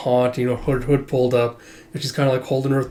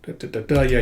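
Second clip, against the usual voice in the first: usual speaking voice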